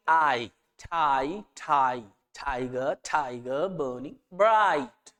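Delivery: thai and tiger stretched very slowly on the ai; exaggerated ai sound. Shown as a demonstration. Teacher isolates the ai vowel for students, very drawn out. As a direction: pull the ai of thai and tiger out long. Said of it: "फिर से वही sound आई".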